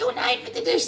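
high, whiny plea; begging. Acted. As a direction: beg him in a high squeaky voice. Wife begs husband not to. (hohes, jammerndes Betteln)

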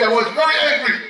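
high, laughing through it; mock anger with laughter. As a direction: giggle out the complaint. Laughing mimicry.

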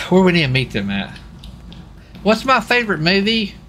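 ordinary talk, nothing marked

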